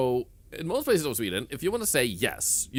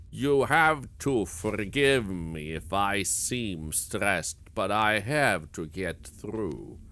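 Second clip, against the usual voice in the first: put-on slow, unhurried old man, lightly lower; very calm elderly man; 4 semitones lower, much slower than usual. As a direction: imitate an old man utterly unstressed, drawing out each phrase. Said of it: "He said it in such a calm manner".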